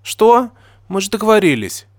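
high, loud indignant outburst; exclaiming. A narrator's reading. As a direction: burst out in startled protest. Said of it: "воскликнула Нина".

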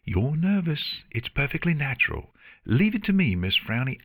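high, perky teasing voice; breezy, teasing Artemis. Acted. Artemis to a frowning Luna, breezily teasing. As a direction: brush off the threat cheerfully.

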